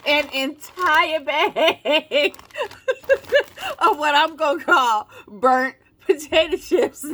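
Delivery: cracking up while trying to explain; laughing. Real, not acted. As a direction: struggle to get it out through laughter. Tickled by the chips' marketing.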